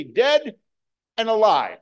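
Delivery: loud, high, punched preacher's catchphrase; emphatic proclamation. Retold or imitated. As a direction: proclaim it loudly, each half set apart by a pause. Quotes own emphatic preaching catchphrase.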